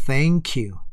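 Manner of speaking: "thank" with TH, between S and T; correct TH pronunciation. Shown as a demonstration. Teacher models correct "thank you" for learners, careful TH. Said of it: "그거의 중간 발음입니다".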